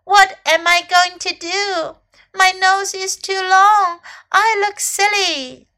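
high, loud lament; dismayed cry. A narrator's reading. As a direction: lament like an upset little elephant. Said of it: "cried Little Elephant".

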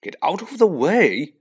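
loud, high irritated snap; impatient outburst; snapping. A narrator's reading. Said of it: "snapped Ron".

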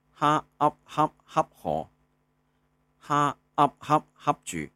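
哈up spelled then 恰 said in tone one; sounding out words. Shown as a demonstration. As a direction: spell 哈 plus up, then say each word. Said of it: "常用字拼读示范".

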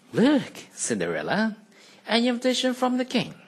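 high, loud and fast sisterly squeal; high sulky sister cry; sulky stepsister crying out. Acted. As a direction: shriek the news at her. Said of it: "cried the sulky one".

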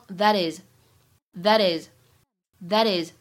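"that is" linked with a flapped t, slow; American flap. Shown as a demonstration. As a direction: say "that is" like "tha-dis". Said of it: "美音浊化".

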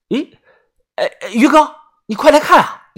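high, urgent call-over; startled calling. Acted. Calling Brother Yu to look, high and startled. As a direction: spot something odd and summon him quickly.

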